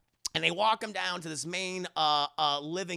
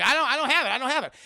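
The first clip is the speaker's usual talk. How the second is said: higher, louder mock panic; panicked denial; 6 semitones higher, 7 dB louder, much faster than usual. Indy denies having the diary, mock panic. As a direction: blurt it out high, half laughing.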